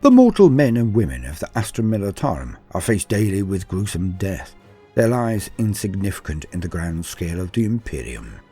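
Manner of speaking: ordinary delivery, nothing marked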